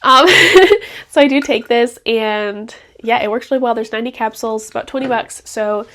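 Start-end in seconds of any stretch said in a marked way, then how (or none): 0.3-1.0 bright burst of laughter; short laugh. Real, not acted. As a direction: laugh at yourself. Laughs at herself.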